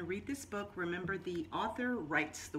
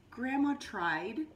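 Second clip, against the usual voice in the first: ordinary delivery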